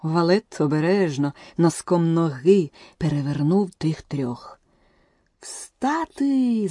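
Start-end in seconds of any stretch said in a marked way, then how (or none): 5.4-6.7 queen's shriek. A narrator's reading. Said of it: "заверещала королева".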